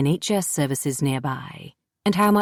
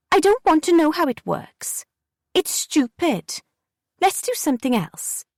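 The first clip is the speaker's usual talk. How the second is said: put-on pitched-up contemptuous kid; scornful girl Jessie; 11 semitones higher than usual. A narrator's reading. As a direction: pick the homemade stick apart.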